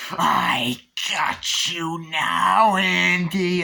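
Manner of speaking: slow, loud rasping whisper; menacing Chucky whisper. Retold or imitated. Chucky threatens Andy from the cart, loud whisper. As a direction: hiss a threat from the shadows. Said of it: "Chucky's on a cart".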